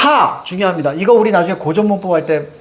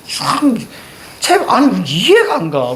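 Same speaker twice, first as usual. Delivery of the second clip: ordinary delivery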